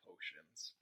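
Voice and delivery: hushed, breathy dread on the word; dreaded whisper. Acted. Narrator whispering the class Harry dreads most. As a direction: whisper it like a thing to fear.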